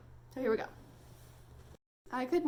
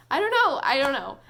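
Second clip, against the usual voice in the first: louder, torn and emphatic; exasperated; 2 semitones higher, 8 dB louder than usual. Real, not acted. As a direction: throw it out louder, at a loss.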